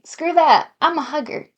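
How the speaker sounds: brash bro. Acted. Voicing Liam brushing off handshake.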